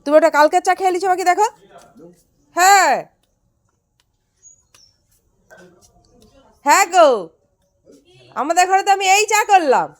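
loud, high call across the house. Real, not acted. Calling out to dada across the house, loud and high. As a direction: shout it so dada hears from afar.

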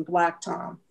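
ordinary talk

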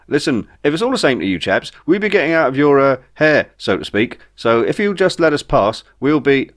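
a chap talking his way out; lightly voiced nervous Spooner. A narrator's reading. Spooner bluffing captors, nervous and polite.

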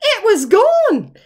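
slow, high gasp of dismay; dramatic reveal. A narrator's reading. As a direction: drop it like a shock after the pause. Suspense reveal after long pause.

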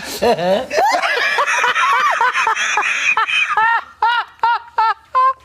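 hysterical laugh. Real, not acted. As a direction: lose it completely. Long laughing fit.

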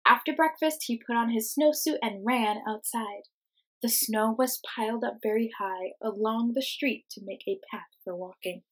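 put-on lively, expressive read-aloud for kids; reading to children. Teacher reading a picture book to her class, lively storytime voice.

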